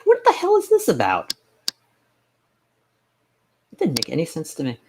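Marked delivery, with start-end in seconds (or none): 0.0-1.3 much higher, far-off squeaky what the hell; incredulous. Retold or imitated. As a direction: squeal it in disbelief. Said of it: "it's like".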